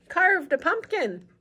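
put-on slow storybook reading for kids; picture-book narration; read-aloud narration. A narrator's reading.

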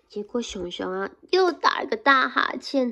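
plain reading voice

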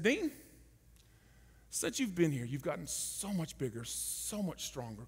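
soft, slow fatherly voice; like a dad. Retold or imitated. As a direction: speak to him gently as a father. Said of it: "kinda like a dad".

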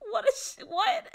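high, laughing what, nearly squeaking; laughing disbelief. Retold or imitated. Reacting to the principal's excuse, high and laughing. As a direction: laugh through the baffled question. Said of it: "I'm like".

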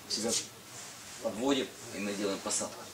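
ordinary talk, nothing marked

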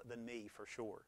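ordinary talk

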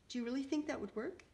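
high, tearful small voice; sobbing. A narrator's reading. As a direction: ask with a touch of a sob.

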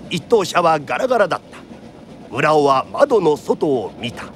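usual speaking voice

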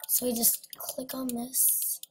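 slow whispered aside. Real, not acted. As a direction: whisper under your breath. Talking to self while clicking.

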